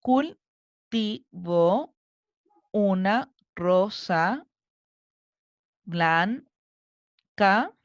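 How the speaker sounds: very slow, long gaps between syllables. Shown as a demonstration. Teacher separating a second verse into syllables, slowly chunked. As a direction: chop each word into separate, drawn-out syllables. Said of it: "Separemos primero en sílabas".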